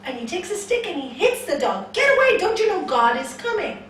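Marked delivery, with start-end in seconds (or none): 1.9-3.8 high, fast, loud yell; angry shout. Acted. Man beating off the dog, shouting angrily. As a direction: scream at the dog, out of patience. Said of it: "you shouted at me".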